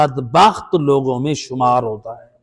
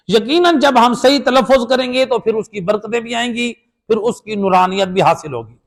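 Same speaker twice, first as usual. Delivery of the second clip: ordinary talk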